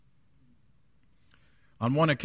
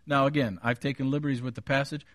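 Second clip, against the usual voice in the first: ordinary talk, nothing marked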